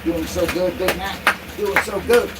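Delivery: high, sing-song pet voice; doggy talk. Real, not acted. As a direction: coo and cheer the dog along. Cheers dog Big Mac on.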